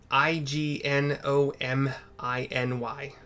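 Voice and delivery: slow, letter by letter; spelling out. Real, not acted. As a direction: separate each letter carefully. Spelling out the difficult word.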